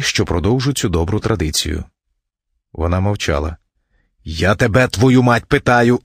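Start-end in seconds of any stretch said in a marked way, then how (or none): 4.2-6.0 raised, emphatic angry burst; angry demand. A narrator's reading. Husband demands answer from silent wife, angrily. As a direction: demand it louder, fed up.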